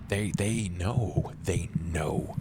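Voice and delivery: second "they know" fading to a whisper; creeping paranoid murmur. Acted. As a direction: let the paranoia sink into a hush. Paranoid character muttering.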